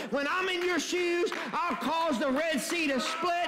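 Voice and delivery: high, ringing preacher's declaration; full-force preaching. Real, not acted. Preacher voices God's promise to walk Moses to Egypt, in full-force preaching.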